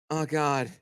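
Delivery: ordinary talk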